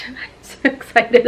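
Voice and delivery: hushed, giggling echo. Real, not acted. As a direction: say it again to yourself, hushed and giddy.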